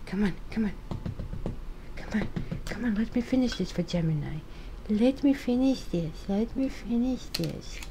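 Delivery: hushed playful scolding of the cat; soft coaxing. Real, not acted. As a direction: gently coax the cat off the cards.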